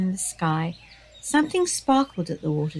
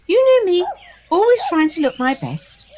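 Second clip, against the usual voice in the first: high Diva voice; 10 semitones higher, 6 dB louder than usual. Acted.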